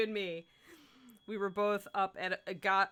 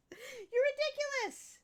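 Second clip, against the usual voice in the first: very high, slow, stretched-out ridiculous; high sing-song teasing at the pet; teasing the dog; much slower than usual. Real, not acted. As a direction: coo ridiculous at the dog, drawn right out.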